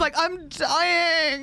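high, drawn out, weak from laughing; helpless laughter. Real, not acted. Host cracking up at guest's answer, helpless laughing. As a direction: wail it slowly as laughter takes over.

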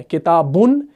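کتابن ending in "-bun", rising. Shown as a demonstration. Teacher reciting the first case, -un ending on کتابن. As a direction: say کتابن as kitabun, short u before the n. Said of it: "تینوں حالتیں کون سی ہیں؟".

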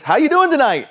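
high, loud cheery hello to strangers; friendly greeting. Retold or imitated. Eric calling to tough guys at a stoplight, cheery and loud. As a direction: call it out brightly across to the next car. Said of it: "he just leans over and goes".

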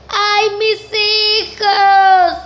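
high, loud, drawn-out ghostly wail; wailing scream. Acted. La Llorona screams for her children, long loud wail.

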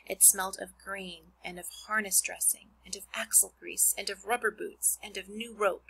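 put-on expressive read-aloud to children; reading a book to a class; story narration. Reads Charlotte's Web aloud.

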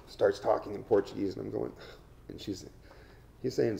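usual speaking voice

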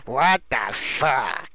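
slow, drawn-out mock game hero; funny game-character voice. Showing a friend how the game's Ben talks, slow imitation. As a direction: imitate Ben with every word stretched out. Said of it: "his voice is really funny".

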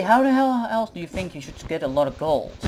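usual speaking voice

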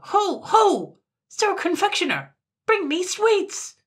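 very high, full-force imperious yell; piercing, demanding call. A narrator's reading. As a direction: bellow the order at the top of your range. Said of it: "called out louder still".

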